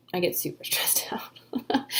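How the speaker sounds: breathy laugh breaking through the words. Real, not acted. Author joking about release-day stress, laughing through it. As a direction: laugh at yourself mid-phrase. Repeats the phrase, laughs second time.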